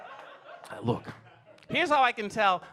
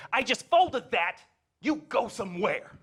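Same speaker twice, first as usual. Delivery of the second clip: mock scolding mom; 5 semitones higher, slower than usual. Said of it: "I hear her say".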